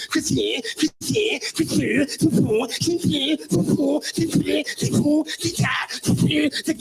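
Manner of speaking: croissant repeated high and nasal-sounding French; mocking croissant chant.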